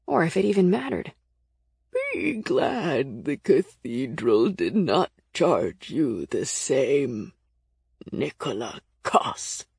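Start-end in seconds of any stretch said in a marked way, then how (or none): 2.1-9.7 very slow, low, creaky warning; old, scratchy voice. A narrator's reading. Blind keeper warns Nick about his fee, creaky and old. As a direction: creak it out slowly, ancient and ominous. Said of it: "their voice so old and scratchy".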